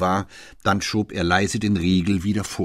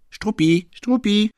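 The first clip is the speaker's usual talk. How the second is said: high, louder repeated call; 7 dB louder than usual. Acted. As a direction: call the dog eagerly. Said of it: "rief Fräulein Otterlo". (lauter, hoher Ruf nach Struppi)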